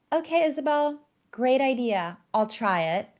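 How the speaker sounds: sweet on top, spite underneath; passive aggressive. Retold or imitated. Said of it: "a spiteful person who's very passive aggressive".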